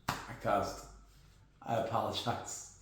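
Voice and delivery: soft mock-contrite mumble; quiet, lightly mock-guilty sorry; sheepish apology. Real, not acted. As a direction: apologize under your breath, half joking. Sheepish apology after cussing.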